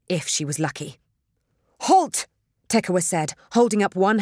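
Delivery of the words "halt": loud, high single-word order; sharp commanding shout. A narrator's reading. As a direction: call out to stop it dead. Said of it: "Tekawa commanded it".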